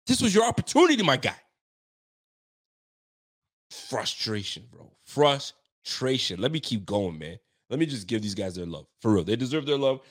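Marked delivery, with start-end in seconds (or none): 3.7-4.9 Frustration said much lower and softer; low frustrated sigh. Real, not acted. Fan venting after the loss, low heavy Frustration. 5.0-6.4 Frustration stretched very slowly; slow drawn-out word. Real, not acted. Fan venting after the loss, very slow drawn-out Frustration.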